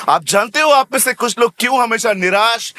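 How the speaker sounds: ordinary talk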